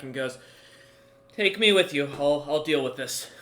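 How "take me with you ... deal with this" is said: loud, pitched-up command; raised voice, decisive; taking charge. Acted. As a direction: step in and take over firmly. Bard volunteers to take charge.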